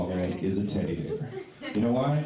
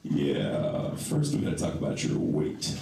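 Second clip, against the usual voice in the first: doctor voice; 8 semitones lower, slower than usual. Retold or imitated. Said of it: "he says".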